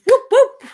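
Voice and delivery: high, loud double whoop; playful whoop. Real, not acted. Host opening the live stream, playful high whoop. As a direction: whoop twice to cheer the show on.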